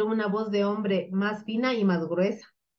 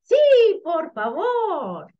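high, long drawn-out plea; eager children; 8 semitones higher, 5 dB louder, much slower than usual. Acted. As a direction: stretch it out like delighted kids. Children gladly accept the walk. (súplica infantil alegre y alargada)

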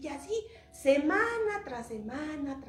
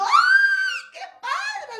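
very high, loud squeal of delight; joyful shout; 14 dB louder, much slower than usual. Acted. Storyteller as Toñito celebrating his paid debt, shouting with joy. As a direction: shriek it like an overjoyed kid. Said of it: "gritó".

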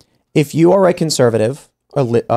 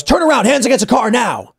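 barked order; 9 semitones higher than usual. Retold or imitated. Stranger ordering kids against car.

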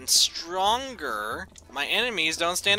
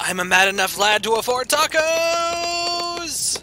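mock triumph; 11 semitones higher than usual.

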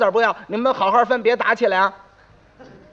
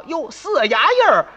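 shocked discovery; 5 semitones higher, 7 dB louder than usual. Acted. Brothers discover fake silver.

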